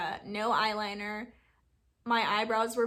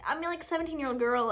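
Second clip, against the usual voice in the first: ordinary delivery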